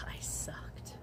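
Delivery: whispered slowly, almost no voice. Real, not acted. Admitting she was a bad hostess, in a slow whisper. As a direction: breathe it out like a confession. Confessing about bad job.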